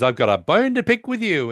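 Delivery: mock Sheldon complaining to his friends; Sheldon impression.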